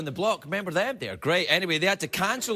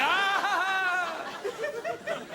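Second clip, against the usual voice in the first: forced laugh; 13 semitones higher, much slower than usual. Retold or imitated. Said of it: "They're like".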